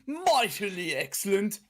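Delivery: hammed-up upper-class British; posh British accent. Shown as a demonstration. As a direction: play a mock posh English gent. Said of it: "Or I could do a posh one".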